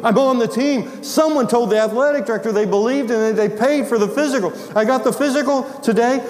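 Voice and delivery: high-pitched, breathless student bursting with news; all excited. Retold or imitated. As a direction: imitate an overjoyed kid telling his teacher. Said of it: "came into my classroom all excited".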